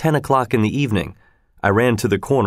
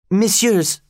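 high-pitched, mildly urgent exclamation; crying out; 9 semitones higher than usual. A narrator's reading. Young woman appealing to the guards, crying out. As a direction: call out in a raised feminine pitch. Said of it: "she cried out".

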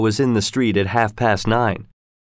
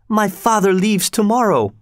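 alarmed cry; 10 semitones higher than usual. A narrator's reading. Daughter realising her father must leave at once, alarmed. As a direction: still reeling in alarm. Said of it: "cried Solange".

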